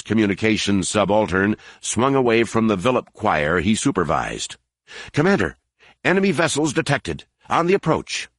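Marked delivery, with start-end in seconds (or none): 5.1-8.3 high, fast alarmed report; urgent alarm. A narrator's reading. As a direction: blurt out the warning in alarm. Subaltern reports sudden enemy attack.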